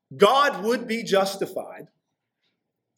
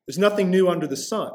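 usual speaking voice

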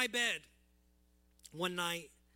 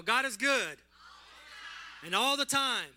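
loud preacher call, pitched up; call and response; 4 semitones higher, 8 dB louder than usual. Real, not acted. Pastor calling out to the congregation, loud and raised.

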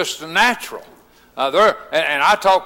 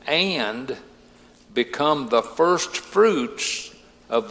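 slow, solemn, quiet Bible reading; lightly voiced reverent reading of scripture; 6 semitones lower, 3 dB quieter, slower than usual. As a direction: read the verses low and unhurried, letting each phrase land.

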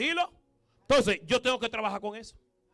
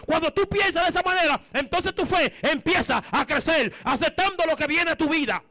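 higher, louder preaching that presses hard on each line; full-force preaching; 5 semitones higher, 7 dB louder than usual. Acted. Preacher to the congregation about keeping faith, in full-force preaching. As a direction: declare it from the pulpit so the whole church hears.

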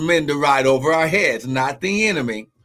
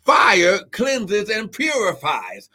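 preaching at full force; 8 semitones higher than usual. Acted. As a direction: climb into the preaching peak. Preaching climax, high pitched.

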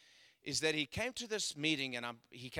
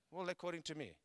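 ordinary talk

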